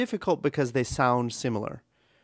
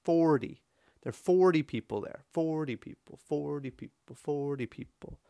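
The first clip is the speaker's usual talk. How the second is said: "forty" stressed on FOR each time, "people" trailing lightly; swinging rhythm. Shown as a demonstration. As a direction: repeat "forty people" in a lilting long-short beat. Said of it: "form a rhythm when you speak".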